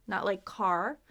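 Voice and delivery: ordinary talk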